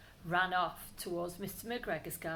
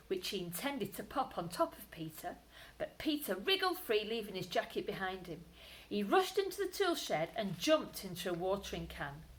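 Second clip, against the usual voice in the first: ordinary delivery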